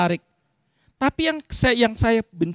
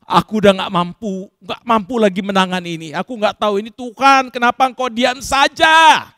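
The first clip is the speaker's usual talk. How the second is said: desperate complaint; 6 dB louder than usual. Acted. Acted desperate complaint to God. (keluhan putus asa, makin keras)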